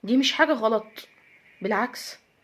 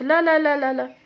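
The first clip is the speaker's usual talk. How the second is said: ordinary delivery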